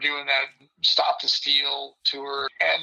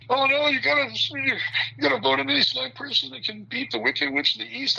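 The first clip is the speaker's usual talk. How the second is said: mocking them in a higher voice; a higher, sneering imitation of the pushy crowd; mocking others; 10 semitones higher than usual. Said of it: "You know, like".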